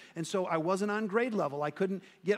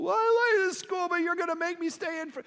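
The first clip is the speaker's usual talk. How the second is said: put-on very high, louder child voice; protesting kid; 11 semitones higher, 4 dB louder than usual. Boy protesting to his teacher about recess, high and whiny. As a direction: whine back like a hurt kid.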